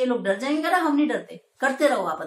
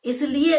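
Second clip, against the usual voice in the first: इसलिए said higher and louder with a rise; emphasis; 4 semitones higher, 4 dB louder than usual. Shown as a demonstration. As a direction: lift इसलिए as a pointed question. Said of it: "teacher repeats key word". (इसलिए पर ज़ोर)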